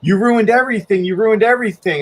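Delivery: high-pitched imitation of frantic blame; mock a panicked accuser, pitched well up; freaking out. Storyteller imitates woman in trouble with hotel, freaking out. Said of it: "and she's freaking out".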